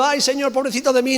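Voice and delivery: mock a whimpering complainer; mock lament to the Lord, lightly whining; mock whining self-pity. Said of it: "lloriqueando y diciendo".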